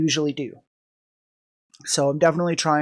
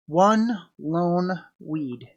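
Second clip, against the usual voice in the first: drawn out; 3 dB quieter, much slower than usual. Real, not acted. Savoring a joke phrase.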